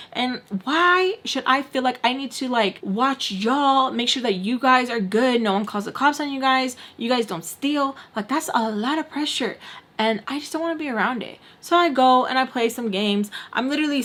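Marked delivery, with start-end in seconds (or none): none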